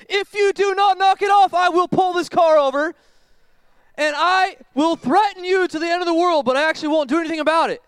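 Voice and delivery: high, loud, exasperated parent threat; angry parent. Acted. Fed-up parent yelling at kids in the car, shrill and loud.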